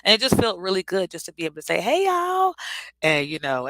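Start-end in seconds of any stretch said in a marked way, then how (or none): 1.8-2.5 high, loud Southern "Hey, y'all" with a cheerful lift; bright Southern accent hello to old friends from Philly; Southern accent greeting. Retold or imitated. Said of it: "somebody told me my accent came back".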